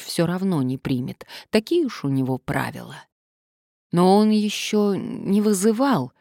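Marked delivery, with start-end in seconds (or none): 3.9-6.1 tentative explaining. A narrator's reading. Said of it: "попыталась объяснить она".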